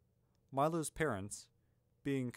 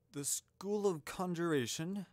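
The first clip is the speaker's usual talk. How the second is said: higher, questioning rise on "Conjuration"; puzzled echo, tune lifting at the end; frowning doubt; 7 semitones higher than usual. A narrator's reading. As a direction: query "Conjuration" as if doubting it exists. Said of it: "Albus frowned".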